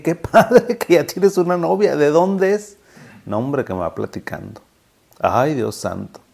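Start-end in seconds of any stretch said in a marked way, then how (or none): 0.0-2.8 much higher, louder, delighted congratulations; happy and excited. Retold or imitated. Doctor delighted his patient has a girlfriend, excited high voice. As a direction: beam at him with delight. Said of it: "Y yo bien contento le dije".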